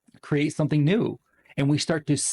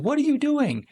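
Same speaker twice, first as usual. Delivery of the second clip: very high-pitched mock outcry; alarmed mom; 4 dB louder than usual. Mom rushes in on dad, alarmed, very high-pitched. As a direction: imitate a mom rushing in aghast. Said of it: "And the mom rushes in".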